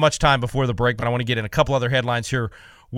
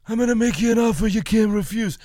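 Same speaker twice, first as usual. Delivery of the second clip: Marlon Brando; 7 semitones higher than usual. Acted. Mobster addressing the committee, in a Godfather impression. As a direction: mumble it like an old movie mafia don. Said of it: "pretty good Marlon Brando impersonation".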